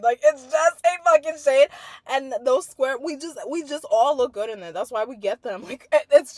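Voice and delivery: loud, insistent gushing over a trifle; worked-up rant. Real, not acted. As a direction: rant loudly in mock exasperation. Worked-up rant about shades.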